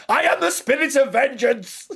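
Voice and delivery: high, loud, laughing through it; laughing villain shout. Pretend. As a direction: roar it like an unhinged avenger. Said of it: "and just going".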